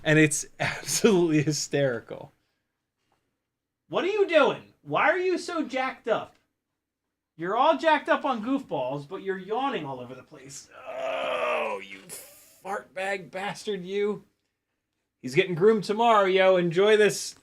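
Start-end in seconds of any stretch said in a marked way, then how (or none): 3.9-10.7 high, sing-song voice for the dog; talking to the dog. Real, not acted. To his dog Teddy, high playful doggy talk. 11.0-14.2 affectionate teasing. Real, not acted.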